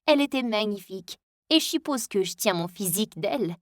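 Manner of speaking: usual speaking voice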